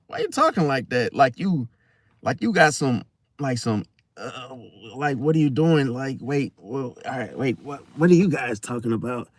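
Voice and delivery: acted weary, subdued irritation; agonized irritation. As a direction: imitate someone quietly exasperated. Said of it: "that agony in their voice".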